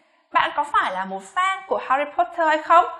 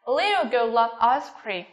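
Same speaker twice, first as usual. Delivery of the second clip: slow demo, voice falling on "ice cream"; falling intonation; slower than usual. Shown as a demonstration. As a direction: let "ice cream" drop at the end. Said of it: "xuống giọng ở cuối câu".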